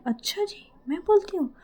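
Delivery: high, rising incredulous retort; mock-offended comeback. Mayra disputes who talks too much, mock-offended. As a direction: throw the accusation back, pitched right up.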